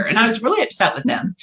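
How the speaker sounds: ordinary talk, nothing marked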